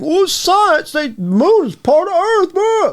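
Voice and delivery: high, sing-song mock of a gullible reader. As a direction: squeak it out like a know-nothing. Mocking what readers think.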